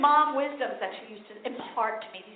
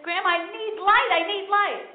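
put-on high pleading child asking grandma; whiny kid voice; 6 semitones higher than usual. Child begging grandmother for light to do homework, high and whiny. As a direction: beg like a kid who needs to read.

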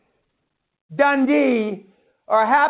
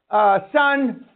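usual speaking voice

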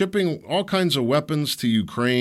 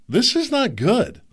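dismayed; 10 semitones higher than usual. Retold or imitated. Dismayed reaction, quoted thought.

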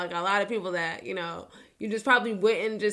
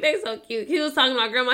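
usual speaking voice

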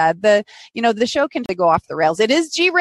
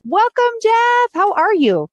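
excited welcome; 11 semitones higher, 3 dB louder, slower than usual. Real, not acted. Host greets guest on air.

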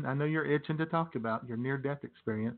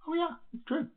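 much higher, squealed catchphrase. Acted. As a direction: squeal it up high. Catchphrase jumps high.

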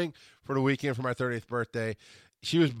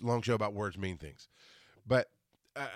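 ordinary talk

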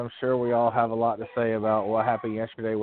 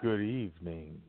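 lower, softer sultry voice; sultry perfume ad; 6 semitones lower, 9 dB quieter than usual. Shown as a demonstration. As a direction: purr it like a perfume ad. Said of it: "or should I say good evening".

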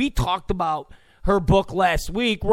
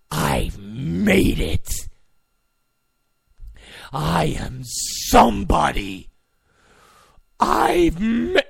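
slow, lower, savoring each word; smug self-important journalist; 3 semitones lower, slower than usual. Retold or imitated. Host mocking journalists who feel they made it, slow smug voice. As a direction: gloat like a reporter who thinks he's arrived. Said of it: "there's a sense of".

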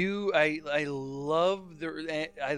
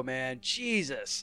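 ordinary talk

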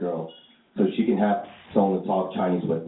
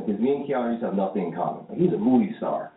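usual speaking voice

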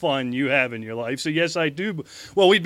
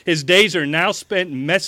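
campaign speech; 2 semitones higher, 5 dB louder than usual. Acted. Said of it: "Will you quit interrupting me".

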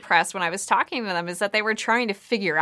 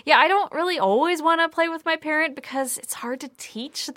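higher, put-on child's voice all through; mimicking a kid; 9 semitones higher than usual. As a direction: voice a reluctant kid, pitched up. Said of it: "the kids were like".

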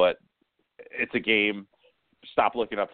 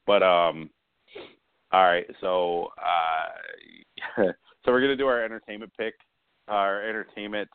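ordinary talk

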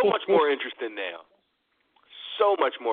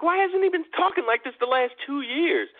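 incredulous; 13 semitones higher than usual. Retold or imitated. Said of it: "I was like".

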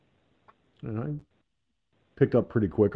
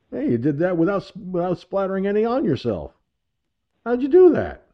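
rising, ever higher and louder amazement; impressed the mess was avoided; amazed farmer; 9 semitones higher, 4 dB louder than usual. Retold or imitated. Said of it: "and he goes".

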